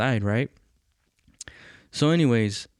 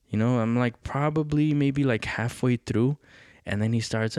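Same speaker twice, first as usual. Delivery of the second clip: normal talking voice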